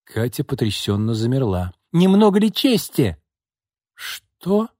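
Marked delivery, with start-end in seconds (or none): 1.9-3.2 high, loud scornful question; sharp contemptuous outburst; indignant exclamation. A narrator's reading. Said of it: "воскликнула она". 3.9-4.7 high, tearful gasp of a question; acted sob on one word; sobbing. A narrator's reading. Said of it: "всхлипнула Катя".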